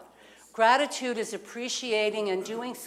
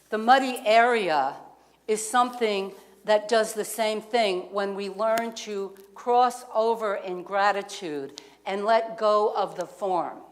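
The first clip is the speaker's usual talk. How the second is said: ordinary delivery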